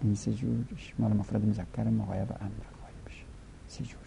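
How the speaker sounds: near-whisper, thinking aloud; hushed aside. Real, not acted. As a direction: drop to a whisper for yourself. Muttered aside while working it out.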